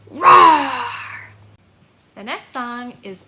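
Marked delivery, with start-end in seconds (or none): 0.1-0.8 huge mock dragon roar. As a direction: roar like a playful dragon for children. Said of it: "Roar like a dragon".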